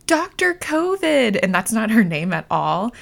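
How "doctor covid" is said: high, slow, drawn-out cry; retold yell. Mom retelling yelling at her doctor in triage, high drawn-out cry. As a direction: call it out high and stretched, half sobbing. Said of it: "yelled out loud".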